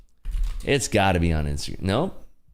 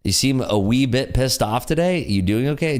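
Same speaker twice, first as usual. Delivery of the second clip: normal talking voice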